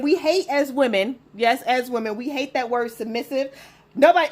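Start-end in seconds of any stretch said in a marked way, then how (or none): 4.0-4.3 sudden loud burst. Real, not acted. Burst before refusal quote.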